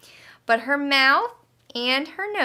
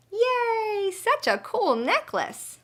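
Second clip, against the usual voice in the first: high happy squeal; delighted cheer; 10 semitones higher, slower than usual. A narrator's reading. Alien thrilled with his shower-puff necklace, gleeful cheer. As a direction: cheer over the new necklace.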